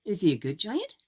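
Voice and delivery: ordinary delivery, nothing marked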